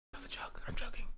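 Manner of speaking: near-silent whisper; hushed, voiceless backtracking; whispered aside. Real, not acted. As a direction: whisper it like a sheepish disclaimer. Backing off a jokey outburst.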